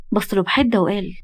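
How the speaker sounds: ordinary delivery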